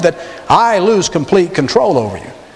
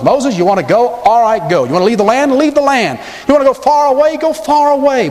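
higher and higher, mock generous king; Pharaoh conceding; 8 semitones higher than usual. Preacher acting Pharaoh giving Moses leave, rising higher. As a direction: climb in pitch as Pharaoh grants each wish. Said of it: "Pharaoh saying to Moses".

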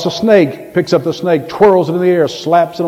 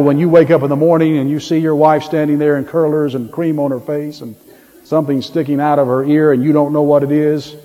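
normal talking voice

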